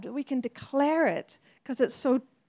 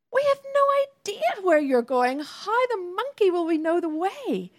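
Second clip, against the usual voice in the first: high-pitched baffled disciple voice; confused Thomas; 6 semitones higher than usual. Retold or imitated. Thomas protesting to Jesus, high and baffled. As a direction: squeak out the question like a lost disciple. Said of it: "And Thomas is like".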